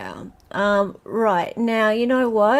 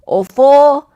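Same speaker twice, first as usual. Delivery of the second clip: loud, emphatic quiz-question reading; quiz host; 6 dB louder than usual. Acted. Host reads a question to online players, quiz host. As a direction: read it out like a quiz host, punching the option numbers.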